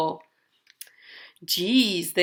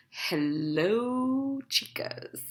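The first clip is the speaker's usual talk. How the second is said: soft, drooling hush fading to a whisper; creepy soft greeting; 11 dB quieter, much slower than usual. Acted. Mister Snake drooling at the chickens, hushed whisper. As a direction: croon hungrily at the chickens.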